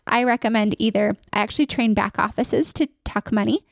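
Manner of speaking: usual speaking voice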